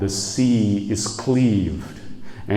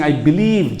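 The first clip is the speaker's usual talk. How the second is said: higher-pitched mock cry; raised voice, half-shouted; retold shout; 5 semitones higher, 3 dB louder than usual. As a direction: call it out as an imitated plea. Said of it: "He is shouting the kalima and saying".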